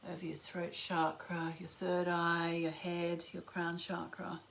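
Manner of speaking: whispered, slow guided meditation; slow soothing countdown. Acted. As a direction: count them down softly into stillness.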